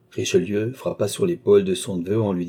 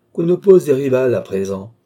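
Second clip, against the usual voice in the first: louder, triumphant outcry; a loud, gloating exclamation; exclaimed; 4 semitones higher, 7 dB louder than usual. A narrator's reading. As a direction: cry it out, drunk on his own plans. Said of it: "s'écria Jean, ivre de ses plans".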